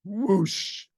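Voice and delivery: whoosh sound. A narrator's reading. Narrator sound effect.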